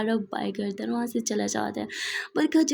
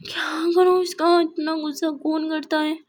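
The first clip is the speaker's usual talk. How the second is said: exasperated fretting; 4 semitones higher than usual. Acted. Barkha alone after being thrown out, high-pitched comic despair. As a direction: throw up your hands at the mess. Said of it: "गुस्से में मेरी पे मार निकली".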